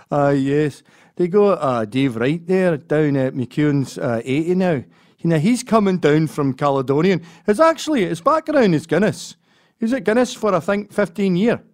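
loud, high Scottish brogue bragging about brewers; Glaswegian voice. Retold or imitated. Glaswegian local bragging about brewers, in a loud high brogue. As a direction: talk up the brewer like a proud Glasgow local. Impersonating Glaswegian beer talk.

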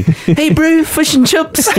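high imitated Kiwi accent. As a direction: mimic a New Zealander's twang. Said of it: "I can hear you practicing".

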